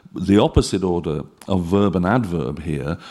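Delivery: normal talking voice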